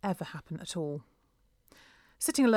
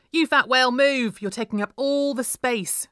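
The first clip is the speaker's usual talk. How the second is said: loud, higher bully scream; 6 semitones higher, 9 dB louder than usual. A narrator's reading. Vivian screams at Leah in the crowded doorway, loud. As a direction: yell it to shove her aside. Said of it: "screamed Vivian".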